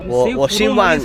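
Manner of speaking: ordinary talk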